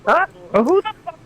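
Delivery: high, indignant demand; who dares talk to me like that; indignant outrage. Acted. Shadowstar demanding who insulted her, high outraged voice. Outraged player reacting in character.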